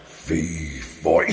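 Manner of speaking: very low giant rumble; giant's voice. Acted. Storyteller shows off his giant's voice, deep rumble. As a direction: drop right down into a deep giant voice. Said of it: "I'll do my giant's voice as well".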